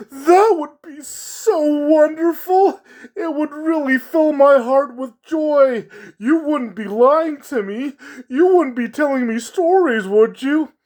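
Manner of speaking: a sad clown choking up with hope; high clown voice on the edge of tears; tearful clown voice. Acted. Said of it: "said in his clown voice".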